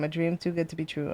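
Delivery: usual speaking voice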